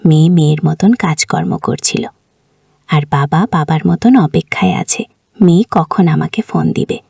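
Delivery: ordinary delivery, nothing marked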